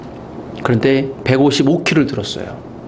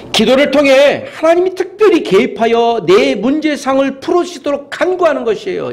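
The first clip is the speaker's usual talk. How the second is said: high, forceful pulpit delivery; fervent preaching; 11 semitones higher, 3 dB louder than usual. Real, not acted. Preacher explaining prayer to the congregation, fervent and high. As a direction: drive the point home at a high pitch.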